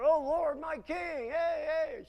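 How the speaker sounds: high loud shouted call. Acted. David hailing King Saul across the distance: loud high call. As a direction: hail the king from far off.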